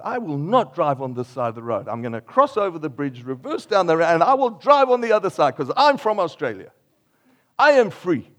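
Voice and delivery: stubborn declaration building to loud, high proclamation; hammed-up obstinate driver; mock defiance. Acting a stubborn Australian driver.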